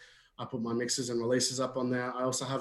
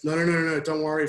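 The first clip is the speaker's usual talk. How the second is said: louder, higher string of refusals; acted brush-off; 4 semitones higher, 6 dB louder, faster than usual. Stuck bloke refusing the fireman's help, acted brush-off. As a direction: hurriedly turn down help, lightly acted.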